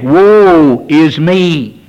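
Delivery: slow, drawn-out lament; crying out in repentance. Retold or imitated. Preacher voicing a repentant sinner, slow lament. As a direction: plead it slowly like a sinner's cry. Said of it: "We'd be saying".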